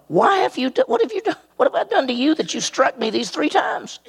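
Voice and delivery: high squeaky donkey voice, pitch jumping way up; talking donkey. Acted. Donkey protesting to Balaam, high squeaky voice. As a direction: plead like a hurt donkey, very high. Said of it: "and she said to Balaam".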